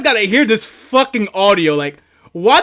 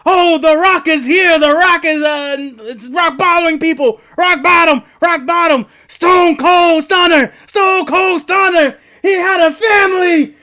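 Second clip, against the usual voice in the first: raised, lightly voiced commentator hype; imitating JR; 6 semitones higher than usual. Streamer recalling JR calling The Rock's moves. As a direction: call the moves like an old wrestling announcer. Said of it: "him saying, like".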